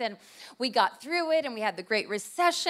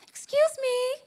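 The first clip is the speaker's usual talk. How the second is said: much higher, sweet polite voice; mock polite excuse me; 13 semitones higher, slower than usual. Wife politely asking a stranger to move her cart, in a high, sweet voice. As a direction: squeak it politely. Polite plea to woman blocking aisle.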